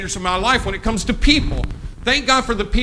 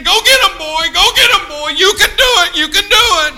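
high loud cheering on; cheering crowd; 10 semitones higher, 9 dB louder than usual. Retold or imitated. Crowd voiced cheering him on.

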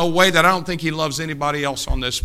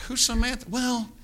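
puzzled grandma question; 8 semitones higher, 6 dB quieter than usual. Retold or imitated.

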